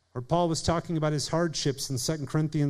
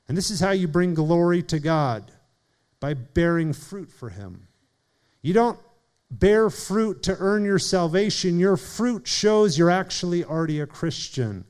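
ordinary talk, nothing marked

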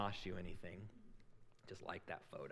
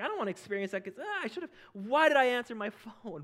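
exasperated whine; 12 semitones higher, 8 dB louder than usual. Retold or imitated. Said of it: "It's like".

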